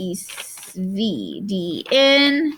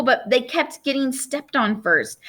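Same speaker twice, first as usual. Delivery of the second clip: ordinary delivery, nothing marked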